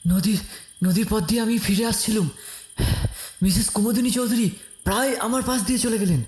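high, breathless panting speech. Acted. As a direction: gasp it out after running. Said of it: "আমি হাঁপাতে হাঁপাতে বললাম".

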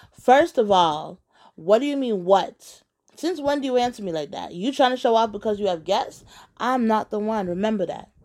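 put-on quarrelling girlfriend, emphatic and offended; indignant telling-off. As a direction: put him firmly in his place. Acted phone quarrel, girlfriend tells him off.

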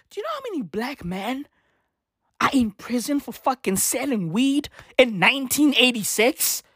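ordinary talk, nothing marked

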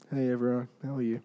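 low, subdued hello; soft, low-key office greeting; unexcited greeting. Acted. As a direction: greet the office with no excitement at all. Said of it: "I'll tell you how I didn't react".

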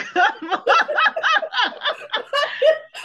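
a long squealing laugh that rises; high-pitched burst of laughter. Real, not acted. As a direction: crack up loudly at your own joke. Laughing at Sarek joke.

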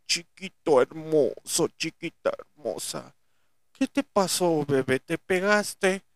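put-on slow, cooing baby talk; mock baby talk. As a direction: coo at them like a pampered baby. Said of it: "les soben el lomito y les digan".

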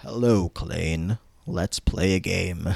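low, slow sinister voice; hammed-up evil villain, drawn out and deep; evil distorted villain. Sue greeting her trapped captives, evil villain voice. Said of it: "in this very evil, distorted voice".

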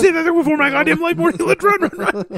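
shrill it out high as fleeing Rick; high, fast Rick impression. Host joining the imitation of panicked Rick, high and fast. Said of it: "He goes".

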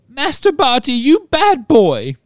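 high squeaky elf, slow and loud; house-elf voice. A narrator's reading. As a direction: scold him in a squeak. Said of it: "whispered Winky".

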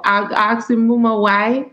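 quoting grandma in light Jamaican patois; a hint of Jamaican patois, storytelling; lightly voiced Jamaican patois saying. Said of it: "speaking Jamaican dialect just then".

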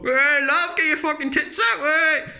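high, hollered catcall; mock catcall. Builder to a girl walking down the street, mock catcall. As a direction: holler a lewd catcall in mockery.

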